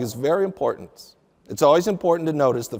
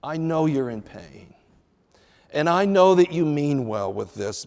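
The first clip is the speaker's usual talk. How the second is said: gentle husband. Pretend. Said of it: "for a godly husband to say".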